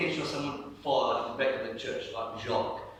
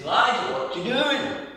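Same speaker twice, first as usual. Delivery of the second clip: higher, louder calling question; booming up high, checking on someone; God calling out; 10 semitones higher, 6 dB louder, slower than usual. Acted. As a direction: call out in a rising, sing-song question. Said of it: "he says".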